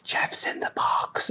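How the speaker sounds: slow hushed whisper. Retold or imitated. Coworkers plotting to throw Jeff down the stairs, slow secret whisper.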